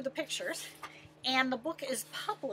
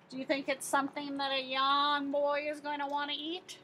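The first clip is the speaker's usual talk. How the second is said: ordinary delivery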